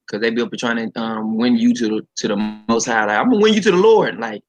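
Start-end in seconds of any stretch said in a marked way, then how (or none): 3.2-4.2 mock preacher. Mocking preachy proselytizers.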